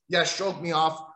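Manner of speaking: usual speaking voice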